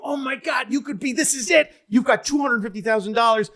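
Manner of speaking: a frantic, high-pitched shout egging him on; excited contestant. Retold or imitated. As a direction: yell it like a man who can't believe the money. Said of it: "the guy's going".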